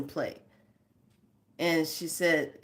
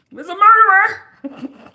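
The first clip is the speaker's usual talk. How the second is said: much higher and louder, half laughing; outburst; 13 semitones higher, 15 dB louder than usual. Real, not acted. Host blurting about Columbus, loud laughing outburst. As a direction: blurt it out, cracking up.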